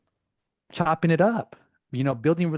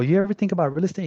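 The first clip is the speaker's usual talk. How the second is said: eager pitch; 8 semitones higher, 4 dB louder, faster than usual. Retold or imitated. Retold pitch to kid.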